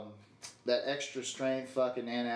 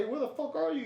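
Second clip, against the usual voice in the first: high nagging parent; 13 semitones higher than usual. Retold or imitated. Parents calling angrily.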